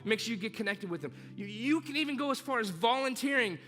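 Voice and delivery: preaching at full force. Acted. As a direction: drive every word home from the pulpit.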